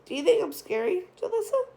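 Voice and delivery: mock-scary question in a hammed-up Barney voice; giggly Barney voice. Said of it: "giggling like Barney".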